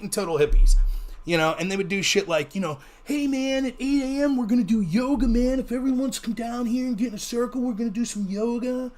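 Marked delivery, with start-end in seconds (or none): 3.0-8.9 laid-back hippie voice, pitched up; fake hippie. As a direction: put on a mellow, singsong hippie announcer. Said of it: "my fake hippie voice there".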